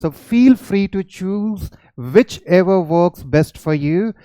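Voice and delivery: slow, deliberate dictation of a note; reading notes aloud. Shown as a demonstration. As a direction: read it out word by word for students. Reads note aloud slowly. (धीरे-धीरे note पढ़कर सुनाना)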